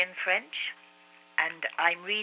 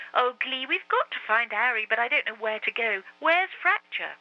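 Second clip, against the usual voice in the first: high, louder voice on the edge of tears; tearful plea; 7 semitones higher, 5 dB louder than usual. A narrator's reading. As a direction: plead through tears to the pony. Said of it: "burst into tears".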